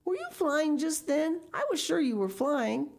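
high, amazed questioning; excited cry. A narrator's reading. Jacques thinks Miss Pinchin flew, excited cry. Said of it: "cried Jacques".

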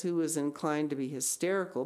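plain reading voice

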